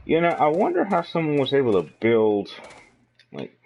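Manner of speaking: ordinary talk, nothing marked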